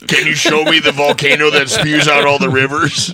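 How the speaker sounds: loud, high pompous explorer. Pretend. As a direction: boom out a grand naive question. Mock explorer asking Indians.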